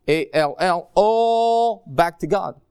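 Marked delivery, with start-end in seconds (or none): 0.1-0.9 A L L spelled letter by letter. Shown as a demonstration. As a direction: spell each letter of the word. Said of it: "that goes all".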